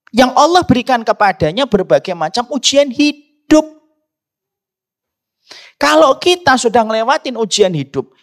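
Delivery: high, loud, driving sermon delivery; impassioned Friday sermon voice; fervent preaching. Acted. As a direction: preach it out to the whole hall. Preaching at full force.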